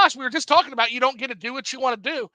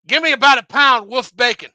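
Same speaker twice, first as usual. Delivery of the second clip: a lightly voiced old customer, a bit higher and much louder; old man with false teeth; 3 semitones higher, 10 dB louder than usual. Old customer ordering at the meat counter, loud mumbling. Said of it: "he had false teeth".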